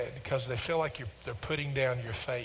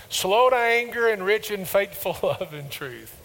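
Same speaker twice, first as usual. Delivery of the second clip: recited louder and higher, a laugh mid-line; louder unison recital; 7 semitones higher, 8 dB louder than usual. Shown as a demonstration. Preacher leading congregation in a louder, higher recital. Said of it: "Say it with me".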